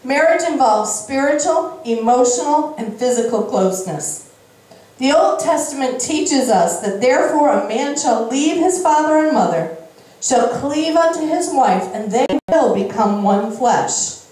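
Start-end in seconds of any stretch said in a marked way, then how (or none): none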